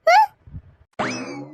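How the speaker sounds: long high rising hmm, drawn out very slowly; a slow disbelieving hum that climbs; drawn-out scoffing hum. Acted. Shamma scoffing at her sister-in-law's taunts, drawn-out hum.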